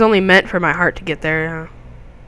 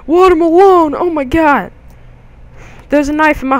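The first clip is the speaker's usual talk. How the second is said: much higher, loud cry of delight; excited shout; 9 semitones higher, 5 dB louder than usual. Real, not acted. Gamer spotting a watermelon, shouting excitedly. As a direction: yell it out like finding treasure.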